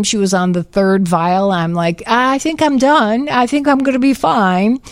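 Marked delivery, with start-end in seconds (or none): 2.1-4.8 higher-pitched, mock plea to stop; retold nervous protest. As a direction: act out begging off mid-treatment. Retold plea during painful injections.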